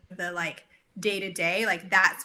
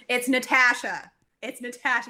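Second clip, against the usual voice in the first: imitating a higher, insistent corrector; insistent correction; 4 semitones higher, 3 dB louder than usual. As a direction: correct them pointedly, mimicking a pedantic partner. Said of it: "would constantly correct people".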